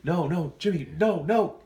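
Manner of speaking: plead, rising in alarm; acted-out panic, pitch climbing; panicked victim.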